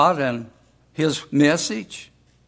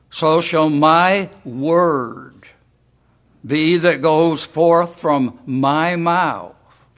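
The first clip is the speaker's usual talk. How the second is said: "word" weighted, slow and louder; slow right down and lean on "word"; emphasis on word; 2 semitones higher, 4 dB louder, much slower than usual. Shown as a demonstration. Preacher puts emphasis on the word while reading the verse. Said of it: "We're gonna put the emphasis on the word".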